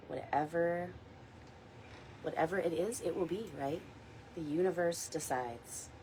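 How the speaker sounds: soft, unhurried meditation guide voice; gentle guided meditation read. Acted. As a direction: ease the listeners into calm. Leads a weekly guided meditation.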